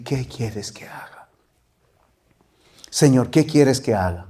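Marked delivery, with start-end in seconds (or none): 0.0-1.3 soft, unvoiced surrender to God; whispered prayer. Shown as a demonstration. Preacher showing the congregation what to pray, whispered. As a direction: whisper it as your own prayer.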